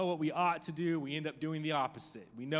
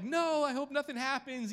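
worried wife; 10 semitones higher than usual. Retold or imitated. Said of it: "my wife's like".

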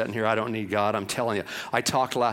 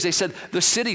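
ordinary talk

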